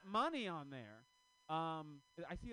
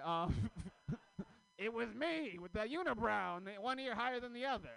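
normal talking voice

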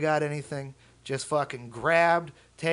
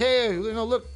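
boasting cops; 5 semitones higher than usual. Retold or imitated. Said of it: "they just be like".

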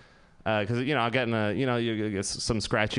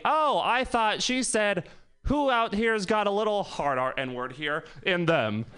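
mock yell; 6 semitones higher, slower than usual. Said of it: "decides to yell out to everybody".

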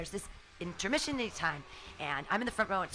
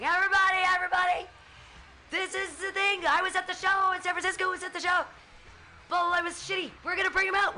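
rallying the crowd; 12 semitones higher, 5 dB louder than usual. Retold or imitated. Standing on chair addressing arena.

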